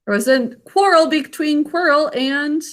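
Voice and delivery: usual speaking voice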